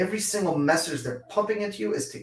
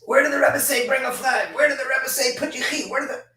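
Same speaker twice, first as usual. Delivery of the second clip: mock heckling, pitched up; imitated yelling critics; 7 semitones higher than usual. Yeshiva boys heckle him over his flag, mock yell. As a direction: throw the accusations back like the hecklers did. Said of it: "all these guys screaming and yelling at me".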